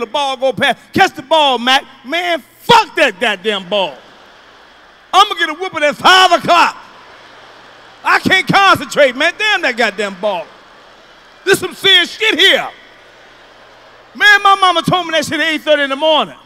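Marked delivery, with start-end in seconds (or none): 0.9-1.8 loud shout across the field; calling out. Retold or imitated. 2.0-14.8 put-on loud, higher frantic kid; panicked kid. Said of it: "I be in center field".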